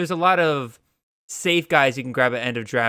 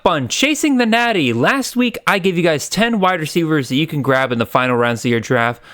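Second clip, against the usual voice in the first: upbeat, louder show teaser; announcer voice; 5 dB louder than usual. Acted. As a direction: pitch the upcoming episode with energy. Podcast teaser intro.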